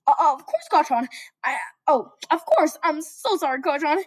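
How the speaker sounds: high, flustered stammering; sputtering Deku. Acted. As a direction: stammer apologies nervously. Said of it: "started sputtering".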